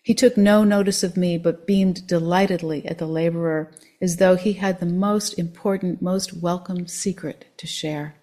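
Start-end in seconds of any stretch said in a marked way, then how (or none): none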